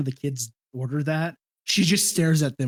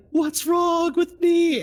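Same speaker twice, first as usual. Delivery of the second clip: put-on panicked kid's cry; 13 semitones higher, much slower than usual. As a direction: wail it slowly like a scared sick boy. Sick son calling out at night.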